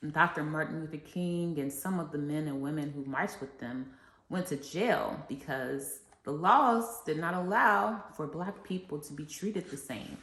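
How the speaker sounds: normal talking voice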